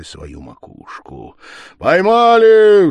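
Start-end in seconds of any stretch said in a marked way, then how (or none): 1.8-2.9 long, high, loud shout; excited cry. Acted. Constables burst in with news, high drawn-out shout. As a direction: holler the news, stretching it out. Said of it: "вскрикнули вошедшие в это время десятские".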